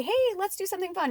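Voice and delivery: high, quick upbeat suggestion; bright, fast, friendly invite; cheerful invitation. Shown as a demonstration. Suggesting fun to a grumpy friend, cheerful and quick. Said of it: "you can say".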